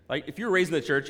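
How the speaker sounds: ordinary talk, nothing marked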